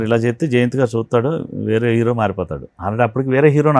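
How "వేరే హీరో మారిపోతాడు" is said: ordinary delivery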